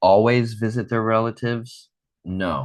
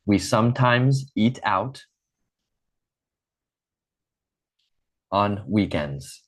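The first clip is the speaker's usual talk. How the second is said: slow model phrase. Shown as a demonstration. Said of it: "Now repeat after me".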